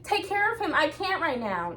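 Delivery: high, pleading mock voice; mother leaving her crying son; lightly voiced frazzled mom; stressed parent. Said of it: "I'm like".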